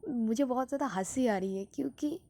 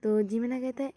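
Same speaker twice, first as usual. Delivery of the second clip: usual speaking voice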